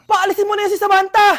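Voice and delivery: loud, indignant order; angry demand. Acted. Child demanding mother evict the friend, angry. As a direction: raise the voice in outrage.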